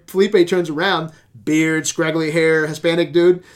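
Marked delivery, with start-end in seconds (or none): none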